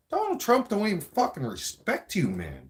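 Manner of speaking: high-pitched angry rant. Real, not acted. Ranting at Trudeau, high and indignant. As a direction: spit it out in disbelief.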